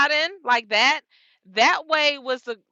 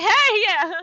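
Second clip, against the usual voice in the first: high, louder relieved reply; relieved yes; 9 semitones higher, 5 dB louder than usual. Retold or imitated. To the helpful driver, high relieved eager yes. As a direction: answer eagerly, glad for help. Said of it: "And I was just like".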